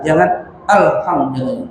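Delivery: ordinary talk, nothing marked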